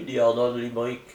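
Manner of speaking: plain reading voice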